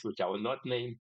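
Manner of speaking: ordinary delivery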